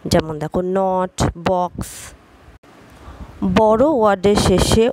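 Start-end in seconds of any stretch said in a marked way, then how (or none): 0.8-1.9 নট, বক্স slowly with a short 'aw'; short o sound. Shown as a demonstration. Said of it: "অ উচ্চারণ হয়". (নট বক্স-এ অ ধ্বনি)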